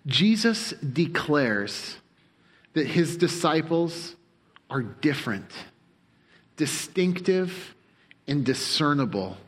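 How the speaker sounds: slow, each phrase set apart with pauses; slow deliberate statement. Shown as a demonstration. Preacher spelling out his summary for the congregation, slowly and deliberately. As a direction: read the summary line slowly, word by word. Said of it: "Have it for you on the screen".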